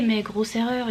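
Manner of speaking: ordinary talk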